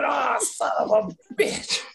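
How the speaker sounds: mock outburst. Mock reacting to a penalty.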